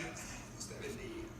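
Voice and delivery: very soft, slow blessing; bendición susurrada. Acted. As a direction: breathe a slow blessing under your breath. Pablo bendice al carcelero suavemente. (bendición lenta en un susurro)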